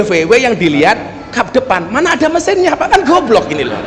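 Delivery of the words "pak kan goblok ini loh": ordinary talk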